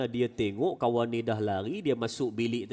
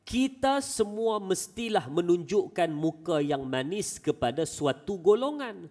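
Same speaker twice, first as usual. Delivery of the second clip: preaching quote; 9 semitones higher, slower than usual. Acted. Quoting Abu Darda's saying.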